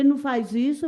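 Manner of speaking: pleading shout, voice jumping up; reliving a desperate yell; retold scream. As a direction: cry out to stop him. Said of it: "eu já comecei a gritar".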